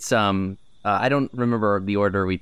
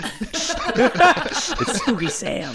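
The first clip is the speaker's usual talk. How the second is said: high, slow spooky intro; 13 semitones higher, much slower than usual. Acted. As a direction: stretch the name out eerily.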